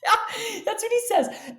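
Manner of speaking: high, laughing yeah of disbelief. Real, not acted. Guest telling host what the principal said, laughing in disbelief. Laughing while retelling remark.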